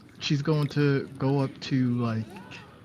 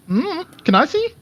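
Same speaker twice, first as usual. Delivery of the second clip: put-on high, loud childlike question; curious little kid; 9 dB louder than usual. Kid pointing at a door, high curious voice. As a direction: pipe up like a nosy small child.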